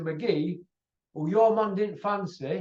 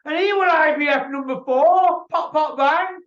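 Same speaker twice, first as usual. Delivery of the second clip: higher and louder, punching each word; excited outburst; 8 semitones higher, 7 dB louder than usual. Real, not acted. Hyping the boxer's knockout to viewers, an excited outburst. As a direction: get fired up on the knockout.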